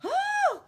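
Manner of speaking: startled shriek; ladies' frightened cry. A narrator's reading. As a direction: scream it in fright. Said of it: "cried the three ladies".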